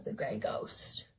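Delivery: hushed, breathy whisper of a secret; soft mysterious whisper; whispered confession. Acted. Maiden's confession in the story.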